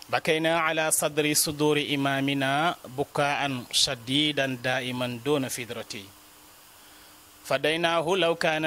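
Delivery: quick, measured Arabic verse reciting; poem recitation. Acted. Teacher reciting an elegy for a sheikh to viewers, poem recitation.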